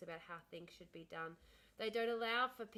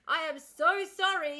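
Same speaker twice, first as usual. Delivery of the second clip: loud, higher, heartfelt apology; emphatic apology; 7 semitones higher, 13 dB louder than usual. Retold or imitated. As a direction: act out an over-the-top sorry. Acted emphatic apology to caller.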